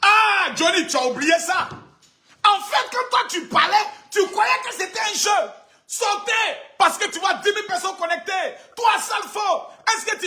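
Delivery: berating Johnny at full voice; angry taunting shout. Real, not acted. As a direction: shout him down.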